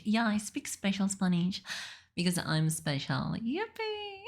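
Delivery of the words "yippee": high, drawn-out yippee; mock cheer. Viewer saying they're special, high mock cheer.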